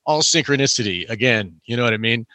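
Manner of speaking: ordinary talk, nothing marked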